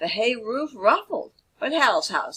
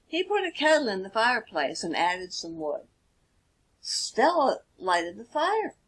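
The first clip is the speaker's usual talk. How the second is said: usual speaking voice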